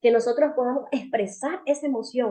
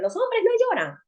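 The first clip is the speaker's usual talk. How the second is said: strict parent; 10 semitones higher than usual. Retold or imitated. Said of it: "los padres que le dicen a los niños".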